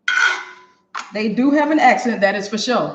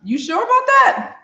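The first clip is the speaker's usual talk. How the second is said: slow, much higher mock doubt; drawn out, teasing the scammer; sceptical retort; 7 semitones higher, slower than usual. To a scam caller, a high slow mock-sceptical reply. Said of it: "I'm like".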